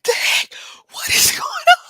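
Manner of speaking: high, loud, laughing outcry; laughing disbelief. Retold or imitated. Retelling her gate reaction, laughing outcry. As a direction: cry out through laughter.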